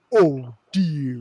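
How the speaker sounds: a scandalized gasped oh dear; clutching pearls oh dear. Retold or imitated. Host mimics a pearl-clutching mother, scandalized. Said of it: "in that tone of voice".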